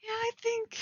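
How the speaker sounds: high, laughing mock delivery, offhand then blurted; mock Sapnap line. Writer parodies Sapnap's blunt confession, laughing and high. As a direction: parody the line as if tossed off casually. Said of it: "Just imagine, like".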